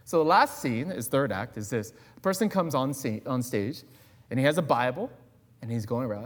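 ordinary delivery

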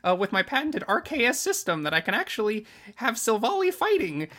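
high, laughing smug boast; boastful trainer. Retold or imitated. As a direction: brag it up high.

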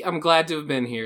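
ordinary talk

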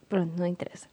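unvoiced whispered aside. Real, not acted. Telling her friend about the walk in Oeiras, a whispered aside. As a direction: whisper it away as if dropping it. Dropping the subject.

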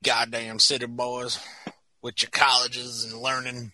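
put-on low, very quiet old-timer grumble; grumbling local. Mill local resenting college boys, low quiet grumble. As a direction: grumble it under your breath, low.

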